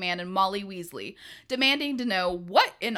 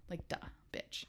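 whispered; 18 dB quieter than usual. Real, not acted. Muttered aside, unvoiced.

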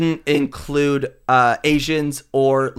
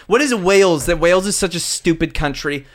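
ordinary delivery

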